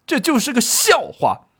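high, scornful acted rebuke; 季布怒斥. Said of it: "唯独季布此时站出来怒斥道". (激愤斥责，高声不屑)

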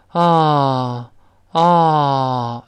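long open "啊", unrounded ah; American open vowel. Shown as a demonstration. Teacher shows American vowel, "啊" long and open. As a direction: relax the lips into a long "啊". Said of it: "在美音中变化成".

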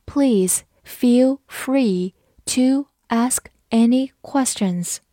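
slow, each word spelled out; slow reading. Shown as a demonstration. Teacher slowing down for the class, slow reading. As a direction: read it slowly for learners. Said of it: "好，慢读一遍".

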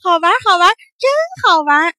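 put-on loud, high-pitched childlike delight; gleeful yelling. A narrator's reading. Naughty boy yelling to the children after hitting a monkey, gleefully. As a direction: crow it out with naughty glee. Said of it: "好玩儿哈哈大笑， 嚷着".

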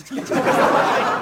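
high, breathless nervous giggle; nervous child laugh. Acted. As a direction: giggle to cover the fear.

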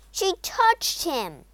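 slow, high moan of horror. A narrator's reading. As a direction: wail it slowly, appalled. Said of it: "moaned Prince Humperdinck".

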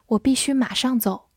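ordinary delivery

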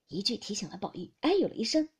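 ordinary delivery, nothing marked

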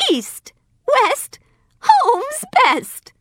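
slow high chant of East, West, Home's Best; magic words. Acted. Glinda teaching the spell, slow and high.

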